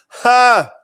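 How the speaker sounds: a single high, loud mocking "Ha!"; scornful ha. Real, not acted. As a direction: bark one loud scoff at the claim. Scoffs at popularity claim.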